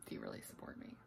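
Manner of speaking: low whispered tease; whispering. Acted. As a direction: whisper the guilt trip. Teasing guilt trip to viewers.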